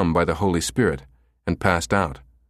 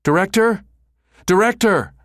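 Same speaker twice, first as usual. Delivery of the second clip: loud desperate calls; shouting; 12 semitones higher, 5 dB louder than usual. Acted. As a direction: yell it across the room. Character shouting for director.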